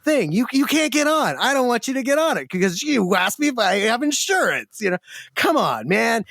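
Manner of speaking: high, firm refusal; firm wife. Retold or imitated. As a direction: stand your ground, no way. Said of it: "she's like".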